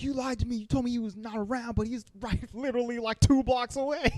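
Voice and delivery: put-on high-pitched aggrieved kid voice; mock accusation. Max confronting his mom about his dad, in mock high-pitched accusation.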